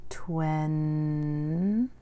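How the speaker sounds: "Twen" stretched long with a lift at the end; teacher blending the syllable "Twen"; slow blended twen. Shown as a demonstration. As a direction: draw "Twen" out smoothly. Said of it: "Say the word twenty".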